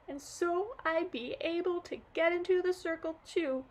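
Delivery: acted girl sobbing to her granddad; crying girl. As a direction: blubber it out through tears. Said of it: "got all worked up and started crying again".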